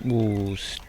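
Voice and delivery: spoken through a yawn. Real, not acted. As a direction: stretch it out mid-yawn. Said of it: "Sorry, I'm yawning again".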